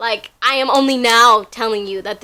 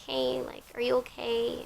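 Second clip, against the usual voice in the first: softer, quieter check-in from the manager; gentle concern; 2 semitones higher, 11 dB quieter than usual. Retold or imitated. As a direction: ask softly like a caring boss. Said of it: "she's like".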